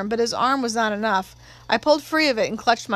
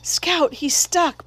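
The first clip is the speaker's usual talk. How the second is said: breathed in alarm; 6 semitones higher, much slower than usual. A narrator's reading. Brother watching a man wedged in a window, alarmed breathing out. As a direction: gasp it out in mild alarm. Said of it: "breathed Jim".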